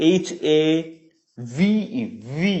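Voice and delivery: ordinary talk, nothing marked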